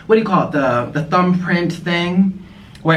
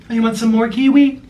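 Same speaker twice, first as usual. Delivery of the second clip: high sing-song offer; pet talk; 8 semitones higher, 4 dB louder than usual. Real, not acted. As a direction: ask the pet sweetly. Offering pet more food.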